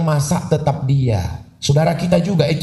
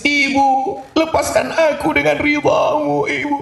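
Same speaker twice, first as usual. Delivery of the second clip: high, whiny tearful plea; high weepy pleading child voice; mock tearful child; 12 semitones higher than usual. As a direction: imitate a sobbing bride pleading to her mother. Child crying at wedding sungkem.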